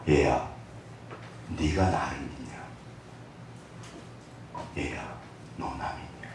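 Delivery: hushed and low, fading to a whisper, like a loving father; hushed fatherly whisper; tender voice of God. Acted. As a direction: speak gently as God to his child. God speaking to believer.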